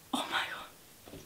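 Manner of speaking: breathless whisper, fighting tears; whispered gasp. Real, not acted. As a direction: barely voice it, choked up.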